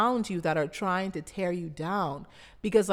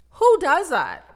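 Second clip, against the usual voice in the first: loud, much higher outraged exclamation; outraged cry; 9 semitones higher, 9 dB louder than usual. Retold or imitated. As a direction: blurt it out in shocked disbelief. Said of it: "And I'm like".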